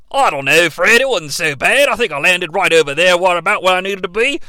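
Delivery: hammed-up broad Australian; mock an Australian dad's drawn vowels; Australian accent. Fred's dad defending his jump to his son, exaggerated Australian accent. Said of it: "cannot contain his Australian accent".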